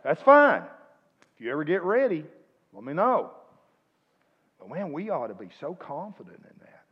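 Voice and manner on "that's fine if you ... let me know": high, loud, breezy no-pressure reply; a loud, easygoing it's-fine answer; breezy reassurance. Retold or imitated. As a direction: brush it off cheerfully and loudly. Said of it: "You say".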